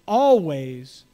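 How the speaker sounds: slow, weighty quotation; slow quoted saying. Retold or imitated. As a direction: draw out each word of the saying. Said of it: "but it's".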